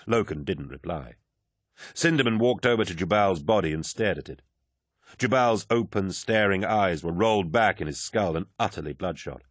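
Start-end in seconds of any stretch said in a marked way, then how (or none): none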